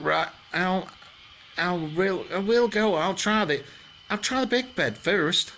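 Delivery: high-voiced storybook girl making up her mind; Goldilocks deciding. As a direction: lift the voice high, thinking aloud.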